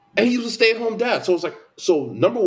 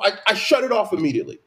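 normal talking voice

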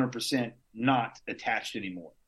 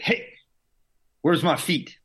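loud, demanding, a bit higher; retold yelling; 6 semitones higher, 4 dB louder, slower than usual. Patient just woke from surgery, yelling at staff. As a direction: bark the question at the staff like a prick. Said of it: "I start yelling at people".

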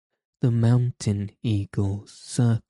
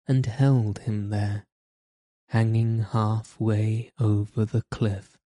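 usual speaking voice

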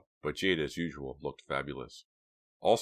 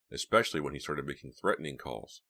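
plain reading voice

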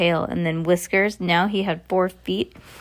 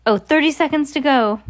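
raised, higher countdown call; crying out; 7 semitones higher, 5 dB louder than usual. A narrator's reading. Grand High Witch counting down while gripping Bruno, crying out. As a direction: call the time out urgently, louder.

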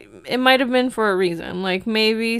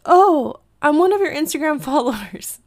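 high, louder burst of recognition; imitated excited fan; 7 semitones higher, 4 dB louder than usual. Follower meets her outside breakfast spot, imitated excitement. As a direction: mimic a thrilled follower.

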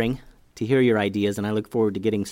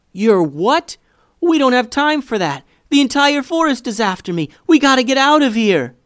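high, loud, frantic yelling; panicked; 13 semitones higher, 9 dB louder than usual. A narrator's reading. Boy fleeing forest animals, urging his sister away, panicked. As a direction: yell urgently that you must escape. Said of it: "Eli was still clearly panicked".

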